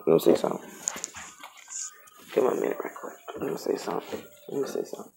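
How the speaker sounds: whispered aside while shuffling; whispered to herself. Real, not acted. Reader thinking aloud over the cards, whispered. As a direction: breathe it to yourself.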